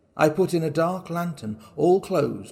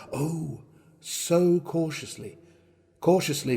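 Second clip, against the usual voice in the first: slow, stretched out; 3 semitones higher, slower than usual. A narrator's reading. Stressing his slow stealth.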